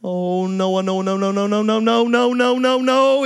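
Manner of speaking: low, repeated pleading no; panicked teenager after denting mom's car; pleading denial. Retold or imitated. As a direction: beg it not to be true. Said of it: "You say".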